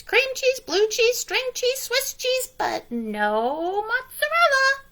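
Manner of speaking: a fussy hen checking the fridge; hen's voice. A narrator's reading. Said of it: "she said".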